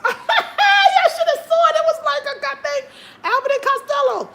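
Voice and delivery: cracking up at the memory; real laughter. Real, not acted. Telling viewers how funny the banana standoff was, shrieking with laughter.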